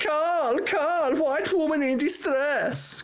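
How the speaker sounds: very high, drawn-out mock Romanian cry; high-pitched, put-on Romanian accent; thick Romanian accent. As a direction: imitate a Romanian coworker calling out in distress. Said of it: "like, Romanian accent".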